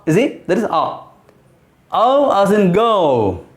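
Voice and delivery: normal talking voice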